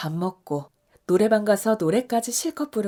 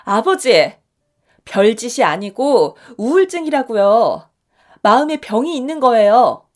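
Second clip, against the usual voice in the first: 크게, 답답함을 눌러 담아 따지듯; 아버지를 설득하려 힘주어; 3 semitones higher, 10 dB louder than usual. Acted. 딸이 아버지에게 항변. (아버지에게 엄마 병을 설명하는 딸의 크고 답답한 항변)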